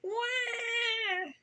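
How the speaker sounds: drawn-out storybook infant cry; imitated baby wail. A narrator's reading. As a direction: wail thinly like a little ghost baby. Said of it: "until a bubble came up".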